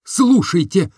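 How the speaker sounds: firm, decisive call; man speaking resolutely. Acted. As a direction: take charge resolutely. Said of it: "сказал я решительно".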